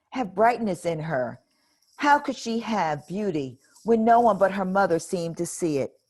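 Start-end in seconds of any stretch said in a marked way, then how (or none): none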